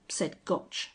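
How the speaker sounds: usual speaking voice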